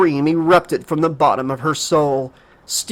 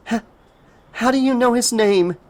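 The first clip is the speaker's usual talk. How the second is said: stammering; 7 semitones higher than usual. Acted. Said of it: "Casey stammered in disbelief".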